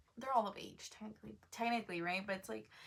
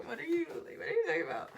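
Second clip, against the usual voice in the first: put-on rising high incredulous girl; sassy disbelief; 7 semitones higher, 4 dB louder, faster than usual. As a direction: call her out, pitch climbing. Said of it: "I'm like".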